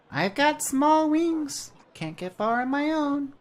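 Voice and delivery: very high, slow, squeaky sprite voice; a tiny bird-sized helper, cheerful; chirpy little creature. Acted. Jan introduces himself to the traveller, high and chirpy.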